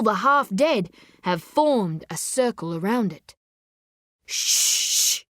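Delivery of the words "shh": long hushing "shh"; drawn-out warning shush; admonishing shush. A narrator's reading. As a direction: shush someone in reproof. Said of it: "turning from the circle to admonish".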